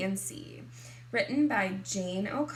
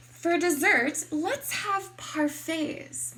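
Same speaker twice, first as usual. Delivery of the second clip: high, drawn-out dessert suggestion; fancy mom; 6 semitones higher, 6 dB louder, slower than usual. Acted. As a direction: play a mother putting on elegance. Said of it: "my mom says".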